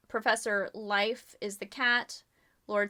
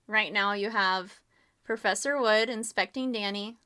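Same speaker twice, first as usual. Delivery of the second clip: ordinary talk, nothing marked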